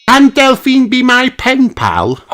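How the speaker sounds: put-on high eager little girl; childlike high plea; Peppa voice. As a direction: beg the teacher excitedly. Peppa asks.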